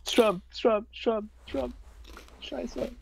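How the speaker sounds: high, mocking Polish accent chant. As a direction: chant the name like a Polish rally crowd. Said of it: "In a Polish accent, though".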